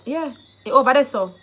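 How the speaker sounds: brisk, pointed retort; retort with attitude. Shown as a demonstration. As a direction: fire the answer back with attitude. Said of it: "attitude begets attitude".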